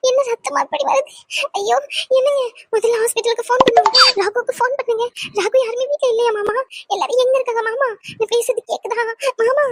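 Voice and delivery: high, frantic cries over his bleeding; panicked. Acted. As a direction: panic as she sees the blood. Finds father-in-law bleeding. (ரத்தத்தைக் கண்டு பதறிய அலறல்)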